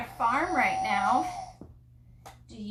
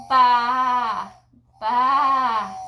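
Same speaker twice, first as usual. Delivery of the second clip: loud, drawn-out baa; sheep bleat; 9 dB louder than usual. Shown as a demonstration. Answering what the sheep says to a toddler, loud bleating. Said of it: "what does the sheep say".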